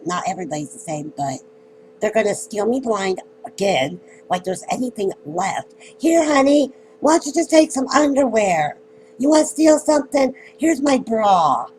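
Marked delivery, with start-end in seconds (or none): 6.0-11.7 high, loud, mocking sweetness; sarcastic mock offer. Mocking the people who steal from her, in a high, loud sarcastic voice. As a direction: hand it over with bitter fake generosity. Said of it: "they're going to be like".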